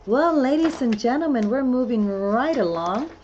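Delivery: loud, high showman announcing the next segment; playful game-show host intro; announcer voice. Acted. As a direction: welcome the crowd like a hammed-up emcee.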